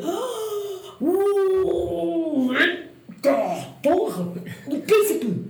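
high-pitched wail breaking into laughter; reliving a starstruck squeal, laughing; excited outburst. Retold or imitated. Runner reliving her first sight of the prince, excited outburst.

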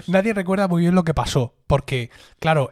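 ordinary delivery

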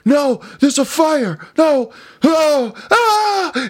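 high, loud hammed-up panic; shrill mock-terror with a laugh at the end; mock bad acting. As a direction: ham it up like a terrible B-movie victim. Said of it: "you hear the worst acting".

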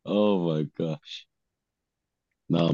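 ordinary talk, nothing marked

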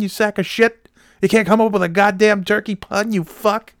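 high, loud screaming anchor tirade; imitated furious anchor. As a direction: blow up at the cohost like a raging boss. Anchor's imagined rage at cohost.

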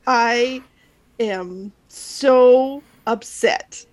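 slow, drawn-out mock complaint; mocking the upset caller. Friend mimics the angry voicemail caller, slow and mocking. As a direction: stretch it out like a whiny caller.

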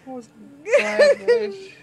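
high loud crying laugh; laughing to tears. Real, not acted. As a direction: laugh till it hurts.

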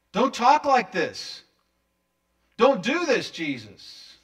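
loud, high, slow scolding; rebuking. Acted. Peter telling Jesus he is wrong, rebuking. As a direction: scold firmly, then drop on the name. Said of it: "Rebuking him".

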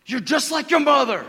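hammed-up parent lashing out; angry parent. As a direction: snap it like a fed-up parent. Parent scolding kid, voiced.

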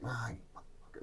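silently mouthing the words, barely audible; mouthed curse. Retold or imitated. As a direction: mouth the insult at him without voice. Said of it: "he's mouthing all kinds of".

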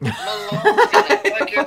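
squealing through a laugh; laughing exclamation. Real, not acted. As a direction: burst out laughing mid-word.

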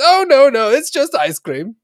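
high, loud, slow cartoon penguin. Penguin correcting the mechanic, high and squeaky. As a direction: squeal the punchline like a little penguin.

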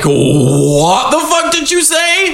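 slow, high, drawn-out mock outrage; hammed-up outraged wail. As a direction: stretch it out and climb in disbelief. Acts out Julie's outraged reaction.

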